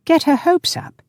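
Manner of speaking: very high incredulous echo; incredulous squawk, pitched right up; gasped disbelief. A narrator's reading. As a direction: throw it back, astonished. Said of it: "he gasped".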